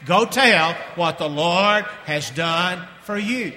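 "Go tell what the Lord has done for you" spoken slowly, every word distinct; slow unison phrase. Shown as a demonstration. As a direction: lead the congregation through it slowly. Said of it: "One more time".

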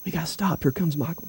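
quick hushed warning whisper. Shown as a demonstration. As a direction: gossipers hushing as someone walks in. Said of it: "do you see the demonstration there".

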